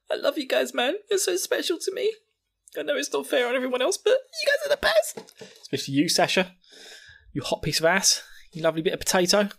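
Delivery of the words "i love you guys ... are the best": high, put-on sentimental voice; mock-tearful squeaky affection; gushing Connie. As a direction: gush over dear friends in falsetto. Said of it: "gives Sasha and Jean a big hug".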